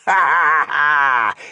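loud, high villainous orc laugh; malevolent laugh. A narrator's reading. Orc chieftain gloating before his army, malevolent laugh. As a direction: laugh wickedly, big and drawn out. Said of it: "He laughed malevolently".

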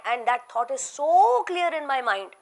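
ordinary talk

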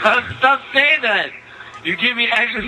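high-pitched, fed-up telling-off; exasperated scolding. Pretend. Player scolding a stubborn kid for yelling, exasperated. Arguing back at the prank soundboard voice.

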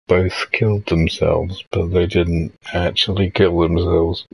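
usual speaking voice

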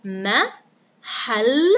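محل in three separate beats, ma - hal - la; split into syllables. Shown as a demonstration. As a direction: spell out the syllables of محل aloud. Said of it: "هيا بنا نقطعها".

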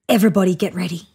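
very fast hurried order; urgent rush. Acted. Baboon hurrying neighbours into position, fast urgent order. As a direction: rush them into place.

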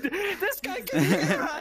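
high, slow laughing words. Real, not acted. Player amused by the game's mockery, laughing hard. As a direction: talk through helpless laughter. Laughing at the game mocking them.

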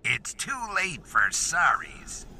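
slow, creepy Krampus threat; dark and menacing. A narrator's reading. Krampus refusing the children's apology, dark and slow. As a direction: let the menace drip. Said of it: "voice now entirely too dark and menacing".

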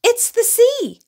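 very high, loud thrilled shout; excited cry. A narrator's reading. Little bear crying out to Daddy at the lake, very high and loud. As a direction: burst out in pure delight. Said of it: "shouted Little Bear with an excited cry".

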